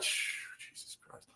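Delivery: whispered, exasperated hush; hushed exasperation. Retold or imitated. Alien handler hushing the abductee as they drag him off, exasperated whisper. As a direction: hiss it under your breath in annoyance.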